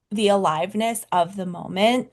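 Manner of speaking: ordinary talk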